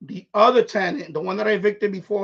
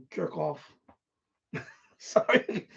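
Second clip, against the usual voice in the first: normal talking voice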